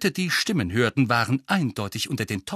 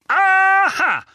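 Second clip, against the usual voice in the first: high triumphant shout; loud, gloating bellow; roaring; 10 dB louder than usual. A narrator's reading. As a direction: roar it like you've caught him out. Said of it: "rührte Onkel Vernon".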